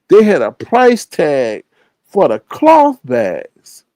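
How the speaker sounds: ordinary talk, nothing marked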